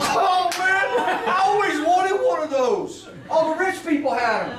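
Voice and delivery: high, gushing mock delight; hammed-up excitement. Customer offered monogrammed initials, comically thrilled. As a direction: gush like a customer who is completely sold.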